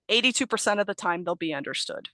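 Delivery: ordinary delivery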